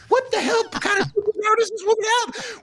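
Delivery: high, loud amazed outburst; astonished. Retold or imitated. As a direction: blurt it out in total disbelief. Amazed staff reaction retold.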